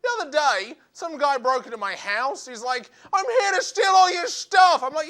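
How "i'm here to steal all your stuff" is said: loud, high burglar announcing himself; burglar's shout. Retold or imitated. Burglar shouting at comedian in his house. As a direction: shout it like a cheerful thief.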